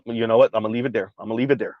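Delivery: usual speaking voice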